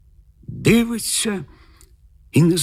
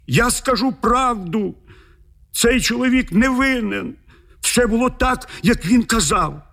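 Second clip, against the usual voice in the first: panicked yelling; 9 semitones higher than usual. A narrator's reading. The lord begs the bear to back off and confesses, crying out in panic. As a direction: cry out way up high, begging. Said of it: "пан зарепетував".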